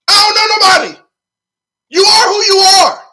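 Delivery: loud high shout. Real, not acted. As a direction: yell it out. Heated rant, shouting.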